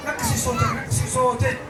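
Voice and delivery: soft, urgent shushing; hushing. Acted. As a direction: quietly tell her to keep it down. Hushing her to lower voice.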